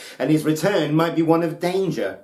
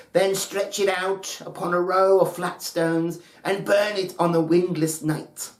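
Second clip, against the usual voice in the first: put-on 1 old mother's instruction; 6 semitones higher than usual. A narrator's reading. Mother instructs her son.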